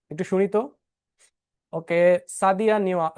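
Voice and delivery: normal talking voice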